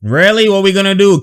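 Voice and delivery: ordinary talk, nothing marked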